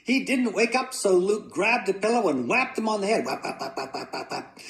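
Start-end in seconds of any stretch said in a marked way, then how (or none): 3.2-4.6 quick, evenly chopped sound effect; rapid whacking noises. A narrator's reading. Boy whacking father with a pillow, rapid whacks. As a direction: rattle off the thwacks in quick rhythm.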